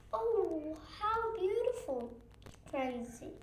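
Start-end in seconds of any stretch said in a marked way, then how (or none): 0.1-2.1 breathless, higher and quick; a hushed gasp of amazement. A narrator's reading. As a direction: gasp in wonder.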